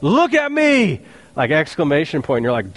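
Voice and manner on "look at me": high, slow, commanding yell; preacher acting out Peter's sharp call to the beggar. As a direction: snap his attention up to you. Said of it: "looked intently at the man and yelled".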